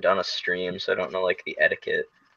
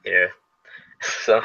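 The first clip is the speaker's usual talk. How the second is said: normal talking voice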